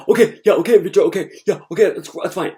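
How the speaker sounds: hammed-up dad brushing him off; imitating dad. Dad waving off his son, mock high loud voice. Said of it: "So my dad's like".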